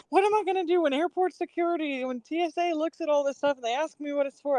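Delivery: high, fretting husband voice; mock-anxious husband imagining airport security; worried husband. Husband worrying about explaining the crystals to security, fretful. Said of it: "he's like".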